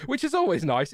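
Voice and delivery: words bubbling through a laugh. Real, not acted. Streamer chuckling about a free PC, laughing. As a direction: still chuckling as he says it.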